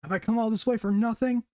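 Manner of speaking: half-shouted urging to himself; retold inner scream. Ichigo urging himself in his head, retold as a mild scream. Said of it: "screaming at himself in his head".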